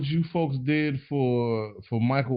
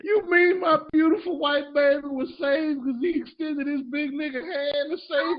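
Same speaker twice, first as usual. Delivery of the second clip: much higher, put-on gushing mother voice; 13 semitones higher than usual. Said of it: "That impression was terribly accurate".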